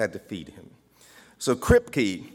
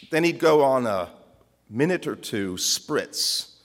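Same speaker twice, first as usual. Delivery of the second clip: ordinary talk, nothing marked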